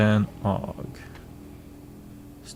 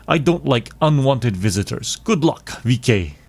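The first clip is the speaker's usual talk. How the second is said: put-on higher-pitched amateur Russian accent; 4 semitones higher, 4 dB louder than usual. Shop owner offers evicted friend a hideout, Russian accent. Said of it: "doing my Russian accent for Kohler dialogue".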